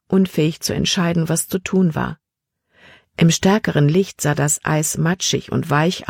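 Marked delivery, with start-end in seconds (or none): none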